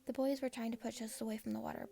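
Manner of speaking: plain reading voice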